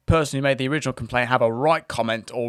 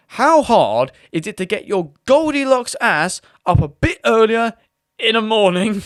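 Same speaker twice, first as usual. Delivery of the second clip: cheeky mocking comeback; 7 semitones higher, 5 dB louder than usual. Support agent taunts the late customer, higher and mocking. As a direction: stretch out the jibe with a cheeky sneer.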